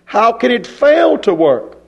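normal talking voice

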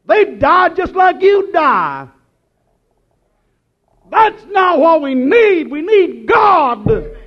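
high, loud preaching, slowing; full-force preaching. Real, not acted. Preacher shouting to the church, slowing at the end. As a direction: drive it home to the church.

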